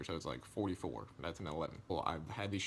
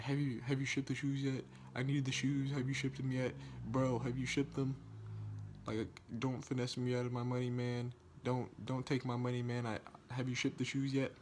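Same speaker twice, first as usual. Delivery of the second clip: higher, insistent nagging in one repetitive run; pestering buyer; 4 semitones higher than usual. Retold or imitated. Buyer badgering the seller to ship his shoes, pestering. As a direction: imitate someone pestering, pitched up.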